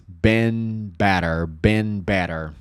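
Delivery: been better very slow, t flapped; drawn out been better as a model; slow drill. Shown as a demonstration. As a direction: been better said slowly for repetition. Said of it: "uma última vez".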